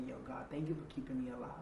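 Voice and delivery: fast whispered thanks; whispered prayer. Retold or imitated. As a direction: whisper a grateful prayer under your breath. His daily prayer to God.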